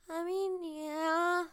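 high, wobbly mock kid voice; depressed, wavering voice. Third grader admitting to being bullied, high wavering voice. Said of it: "my voice was, like, moving".

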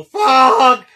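big, high teasing yell; imitated scream. Teasing a friend about his next reaction, imitated scream. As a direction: mimic his outburst at top volume. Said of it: "Just so you can go".

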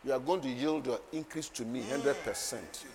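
normal talking voice

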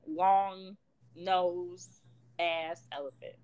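usual speaking voice

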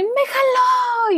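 put-on high, slow, whining little girl; whiny crying kid. Aunt imitating crying niece who got pushed, whiny. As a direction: wail it like a toddler tattling. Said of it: "y llora".

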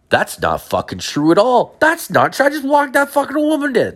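very high-pitched, squealing denial; hammed-up indignant squeal; exasperated protest. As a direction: protest your innocence, climbing way up high. Acted denial of false rumour.

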